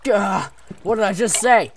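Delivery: loud, high exasperated shout; frustrated yell. Real, not acted. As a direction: yell at the bad luck. Attacked again.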